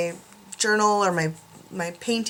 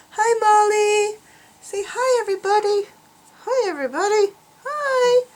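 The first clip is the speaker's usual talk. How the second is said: pet talk; 10 semitones higher than usual. Pretend. Owner has dog greet viewers, high pet talk.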